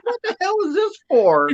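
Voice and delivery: high, laughing mock outrage; imitating a startled, laughing complaint; baffled Kate. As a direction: squeak it out, amused and confused. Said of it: "She goes".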